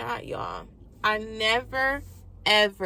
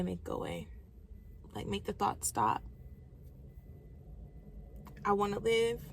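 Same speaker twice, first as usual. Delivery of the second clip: softer, almost whispered pleading; fading pleading prayer; 11 dB quieter than usual. Retold or imitated. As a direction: let the plea sink away to nothing. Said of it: "pleading with God".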